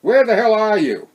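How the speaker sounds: a loud, high call of his name; yelled. A narrator's reading. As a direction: shout his name into the dark. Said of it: "Sandy yelled".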